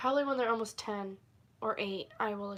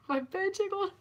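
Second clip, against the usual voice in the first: high-pitched words through laughter; high, giggly squeak; giggling; 10 semitones higher, 6 dB louder than usual. Real, not acted. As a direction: repeat it while cracking up. Laughing at the jiggling bed.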